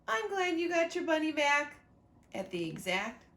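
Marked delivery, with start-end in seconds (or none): 0.1-1.8 put-on two girls cheerfully in unison, pitched up; higher, louder child voice. Both girls speaking together in a higher, louder child voice. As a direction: say it brightly as both kids at once. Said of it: "they both said".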